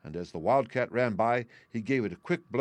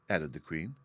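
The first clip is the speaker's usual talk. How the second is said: usual speaking voice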